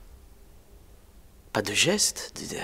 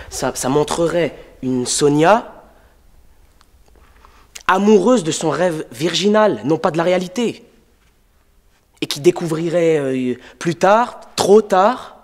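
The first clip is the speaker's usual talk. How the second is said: raised, loud, high-pitched tirade; impassioned outburst; 6 semitones higher, 8 dB louder than usual. Acted. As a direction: build to a loud, high cry of indignation. Playwright passionately berating his actors.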